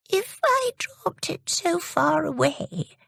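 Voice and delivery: put-on high, slow puzzled old lady's question. Old lady asks Jimmy how he reached her, puzzled and high. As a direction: wonder aloud, puzzled. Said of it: "she asked".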